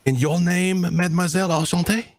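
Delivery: put-on high, flirtatious French accent; French charmer. French NPC greets Oz.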